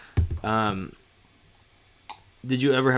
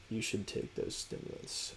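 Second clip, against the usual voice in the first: slow, trailing into a whisper; fading to whisper; 3 semitones lower, 16 dB quieter than usual. Pretend. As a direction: let it fade to a breath. Reads comment, fading whisper.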